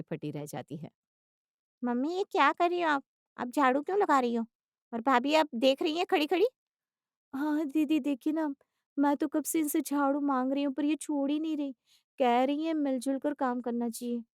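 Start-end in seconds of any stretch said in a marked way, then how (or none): 1.8-6.5 higher, scolding questioning daughter; shocked daughter confronting the household; astonished daughter. Acted. 7.3-14.2 put-on higher, polite young wife voice; earnest daughter-in-law.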